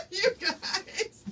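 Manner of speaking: high giggle through the words; laughing. Real, not acted. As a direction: crack up at yourself. Laughing at herself.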